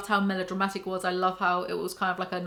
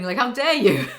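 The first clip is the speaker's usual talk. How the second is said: laughing indignation; 6 dB louder than usual. Real, not acted. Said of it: "Why am I getting so mad?".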